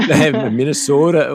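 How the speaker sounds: slow, drawn-out Minnesota vowels; Minnesota accent. Retold or imitated. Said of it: "they kinda have the same kinda tinge to them".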